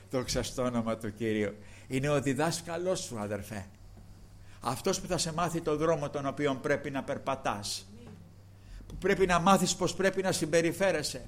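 ordinary talk

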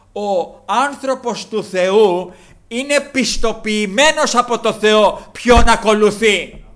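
very slow, loud, high proclamation; slow emphatic preaching. Acted. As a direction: hammer each word home slowly. (αργό, δυνατό, επίσημο κήρυγμα)

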